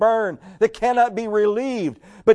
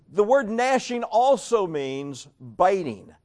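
ordinary talk, nothing marked

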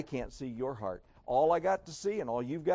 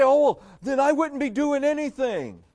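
high, loud mock protest; overblown, put-on exasperated whine; melodramatic objection; 10 semitones higher, 7 dB louder than usual. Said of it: "wants to get melodramatic".

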